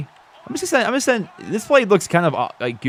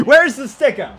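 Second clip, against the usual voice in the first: laughing disbelief; 11 semitones higher, 4 dB louder than usual. Real, not acted. After yet another drop.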